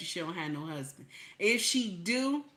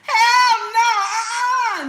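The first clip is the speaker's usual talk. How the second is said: very high, very loud shriek of refusal; outraged hell no; 13 semitones higher, 11 dB louder, much slower than usual. Real, not acted. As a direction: scream it out, total disbelief. Outraged disbelief at news.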